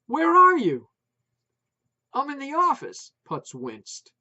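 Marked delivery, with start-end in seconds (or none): 0.1-0.9 high, loud shout over barking dogs; shouting wife. A narrator's reading. Wife shouting over yapping dogs on the phone, loud. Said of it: "his wife complained over the clamor". 2.1-3.1 higher and louder over the din; raised voice. A narrator's reading. Putz answering wife over the dog noise, raised voice.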